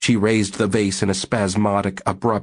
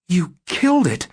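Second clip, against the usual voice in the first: much higher, pointed accusation; accusing; 11 semitones higher than usual. Acted. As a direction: blame him outright. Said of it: "Susie said accusingly".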